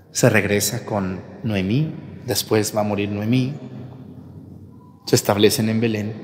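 usual speaking voice

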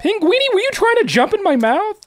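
high, teasing question; teasing Pinguini in a high squeak; squeaky mock voice. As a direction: ask it in a tiny cartoon voice.

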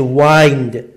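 وایند drawn with an "ai" glide, rhyming with "find"; alternative pronunciation demo. Shown as a demonstration. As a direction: say وایند with the vowel of "eye". (تلفظ «وایند» با صدای «آی»)

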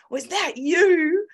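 high, drawn-out gleeful question; delighted question. Retold or imitated. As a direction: ask with a massive grin, amazed to have found them. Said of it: "she's got this massive grin".

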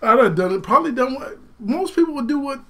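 ordinary talk, nothing marked

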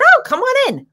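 high-pitched sing-out greeting; imitated welcome. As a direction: imitate someone calling a visitor inside. Voicing welcome at the door.

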